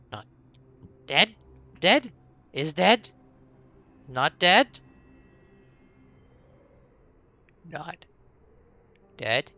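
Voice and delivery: a confused goblin checks whether it is alive; frightened goblin. Acted. Healed goblin asks the orc whether it is dead, in a nervous creature voice.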